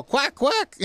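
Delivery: high mock quacking; joking duck imitation, quack quack. Host jokes that ducks answer the interviewer, high mock quack. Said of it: "and they go".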